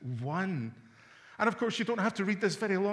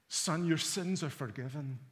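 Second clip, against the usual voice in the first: tender quiet Jesus quote; 5 semitones lower, 7 dB quieter than usual. Acted. Jesus's words quoted softly.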